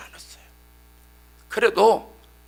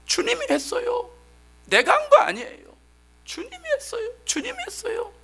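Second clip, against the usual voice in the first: fervent confession; 4 dB louder than usual. Retold or imitated. Repentant pleading to God.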